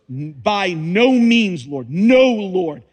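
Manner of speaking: slow, loud, stubborn refusal; emphatic refusal. Acted. Preacher voicing Peter's stubborn no to the Lord, loud and slow. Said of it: "Think of those words".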